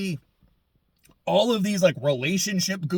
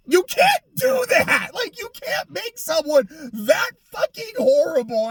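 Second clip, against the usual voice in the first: much higher, loud outraged yell; exasperated shout; 10 semitones higher, 3 dB louder than usual. Real, not acted. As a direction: yell in disbelief at the writers. Exasperated rant at the show.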